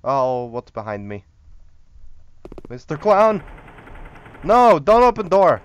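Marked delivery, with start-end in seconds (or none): 2.7-5.6 loud, high-pitched cry to the clown; alarmed shout. Pretend. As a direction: yell to stop him at the door. Calling out to stop clown.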